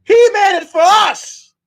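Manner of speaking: high, loud imitated shout; hammed-up fanboy rage; mock furious yell. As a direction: yell it like an outraged fan in a chat fight. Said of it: "he was like".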